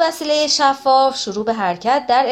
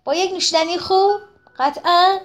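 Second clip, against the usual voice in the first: much higher, eager hopeful question; 9 semitones higher than usual. Acted. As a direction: brighten up at the thought of a drink. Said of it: "با امیدواری گفت".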